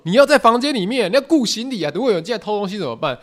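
high, loud mock command, speeding up; bossy order. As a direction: bark the order to guard the bags. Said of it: "就马上被勒令说". (高声大声地命令，语速越来越快)

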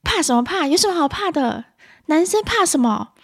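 high-pitched dismissive scolding; irritable mother. Acted. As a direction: act a mum impatient with a child's fear. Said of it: "那暴躁的妈妈会说". (暴躁妈妈高声数落)

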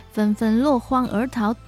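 usual speaking voice

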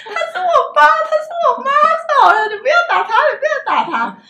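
high, loud sobbing plea to stop the beating; child in a dream begging men not to beat her mother, sobbing; crying child pleading. Retold or imitated. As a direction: wail it like a heartbroken little girl. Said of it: "一直哭，哭到好伤心".

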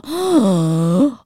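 loud startled gasp. Retold or imitated. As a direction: gasp in mock horror. Reacting to mould spot.

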